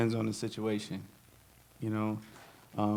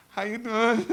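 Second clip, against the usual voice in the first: much higher, laughing greeting; forced cheer; 10 semitones higher than usual. Pretend. Musician pretending to be fine on stage, laughing high. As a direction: fake a cheerful hello through laughter. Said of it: "You gonna have to do".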